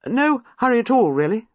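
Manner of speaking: breezy and cheerful, brushing it off. Acted. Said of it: "said Giles cheerfully".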